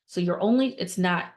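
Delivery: ordinary talk, nothing marked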